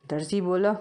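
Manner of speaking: plain reading voice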